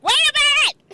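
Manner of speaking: high, loud wait a minute; startled protest. Retold or imitated. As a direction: yelp it like you've just been shoved. Retelling shock at being pushed.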